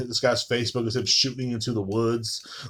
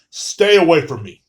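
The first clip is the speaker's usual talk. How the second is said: mock disgust; 3 semitones higher, 7 dB louder than usual. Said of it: "looking at you guys in disgust".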